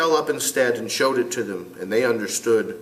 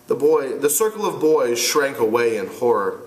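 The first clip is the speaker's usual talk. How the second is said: ordinary delivery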